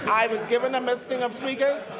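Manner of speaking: ordinary talk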